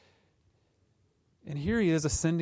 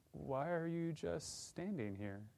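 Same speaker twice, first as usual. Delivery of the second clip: slow and much softer, a gentle challenge; soft questioning Christ; 8 dB quieter, slower than usual. Retold or imitated. Christ to idle believers, soft slow question. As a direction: ask it quietly, slowly, as a gentle rebuke. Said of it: "Christ might come to us and say".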